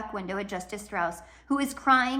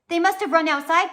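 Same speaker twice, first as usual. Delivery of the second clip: urgent; 8 semitones higher, 7 dB louder than usual. A narrator's reading. Klaus urgently calls out.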